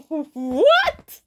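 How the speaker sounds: high, loud, cracking what; stunned what. Retold or imitated. After the stranger walks off, a loud stunned what. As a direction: thrown completely off guard. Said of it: "it's like".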